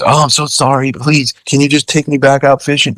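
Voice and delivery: high-pitched pleading imitation; mock apologizing. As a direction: mimic a client begging to be forgiven. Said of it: "he was apologizing and shit".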